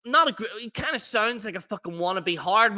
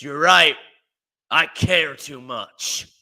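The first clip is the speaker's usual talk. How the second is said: 1 mock Jericho; 4 semitones lower, 5 dB louder, slower than usual. Mocking Jericho's reply.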